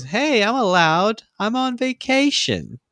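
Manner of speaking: high, slow put-on girl voice; mock April. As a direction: play April, prissy and defensive. Voicing April defensively.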